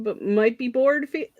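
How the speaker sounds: ordinary talk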